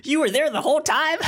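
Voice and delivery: high, fast, startled cry; shocked squeal. Retold or imitated. Students discovering teacher was there all along, high shocked squeal.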